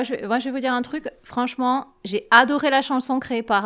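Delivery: ordinary delivery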